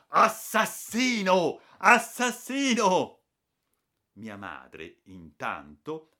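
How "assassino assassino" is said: slow, loud, high furious accusation; snarling. Acted. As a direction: snarl it at the culprit. (ringhiando furioso, lento e forte)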